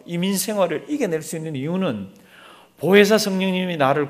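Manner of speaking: rising, forceful sermon delivery; fervent preaching. Acted. Pastor exhorting his congregation, fervent preaching. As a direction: drive the message home to the flock.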